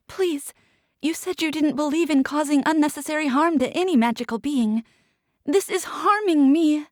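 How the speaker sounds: high, pleading and urgent; desperate pleading. Acted. Captive begging Bay for mercy, high and desperate. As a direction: beg him to spare you.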